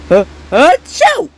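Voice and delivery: slow, high, drawn-out sneeze. Acted. Sick toy sneezing out Thomas. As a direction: wind up then sneeze loudly. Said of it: "I'm sick".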